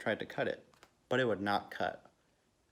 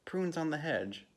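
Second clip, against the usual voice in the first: higher-pitched excited call, no real shout; children calling out; 7 semitones higher than usual. A narrator's reading. Children point out the hedge as they race past, mild shout. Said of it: "they shouted".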